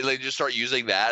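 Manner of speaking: normal talking voice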